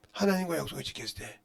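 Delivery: ordinary delivery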